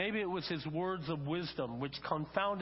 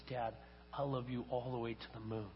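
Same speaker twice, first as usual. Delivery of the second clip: put-on soft, low, sleepy child in a story; tired little rabbit; 5 semitones lower, 6 dB quieter than usual. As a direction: wind down like a drowsy little one. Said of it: "getting tireder and tireder".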